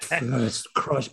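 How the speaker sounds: very soft, low murmur; hushed reverence. Retold or imitated. Men in their forties recalling a first crush, hushed. Voicing fans' wistful crush confession.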